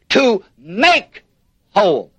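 "make" set apart and stressed, then a pause; teacher underlining "make" before a falling "whole". Shown as a demonstration. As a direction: punch "make", pause, drop low on "whole". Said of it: "The emphasis is on the word make".

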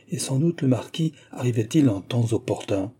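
plain reading voice

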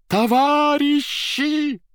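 high, loud, drawn-out scream; frenzied shout. Acted. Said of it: "неистово закричал председатель".

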